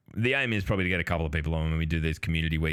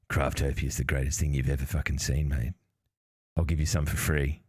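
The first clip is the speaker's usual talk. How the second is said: lightly played shady character, slightly lowered; shady dealer; 5 semitones lower than usual. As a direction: pitch it to a mark like a back-alley dealer, lightly. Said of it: "a shady looking character in a trench coat".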